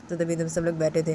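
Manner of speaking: plain reading voice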